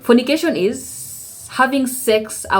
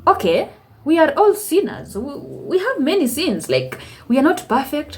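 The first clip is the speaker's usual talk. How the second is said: higher, taken-aback protest to God; incredulous protest; 6 semitones higher than usual. Retold or imitated. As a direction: push back, surprised and defensive. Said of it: "And I was like".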